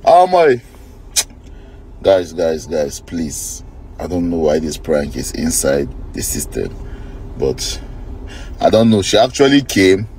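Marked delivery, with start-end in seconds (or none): 2.0-7.8 low, soft worried voice; hushed. Real, not acted. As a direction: drop to a nervous hush. Scared after the prank.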